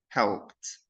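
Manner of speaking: "helped" said as "helpt"; final ED as T. Shown as a demonstration. Teacher giving example after the rule, "helped" with T ending. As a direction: end "helped" on a voiceless T. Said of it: "بننطق ال final E D as".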